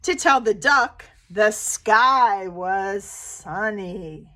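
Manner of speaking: storybook read-aloud with animal voices; gentle picture-book reading; reading to children. Acted. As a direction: read it like story time for little kids.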